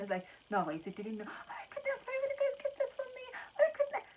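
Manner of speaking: very high squealing delight; excited screaming. Retold or imitated. Daughter squealing with joy at the pregnancy news.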